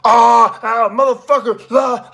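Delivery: loud high anguished yelling, no words needed; venting pure anger in raw shouts; raw rage yells. Shown as a demonstration. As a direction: let out a wordless furious roar. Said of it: "you don't even need words".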